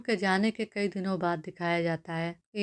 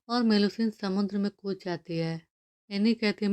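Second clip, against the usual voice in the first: usual speaking voice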